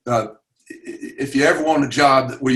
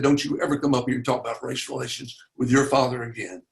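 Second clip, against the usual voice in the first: normal talking voice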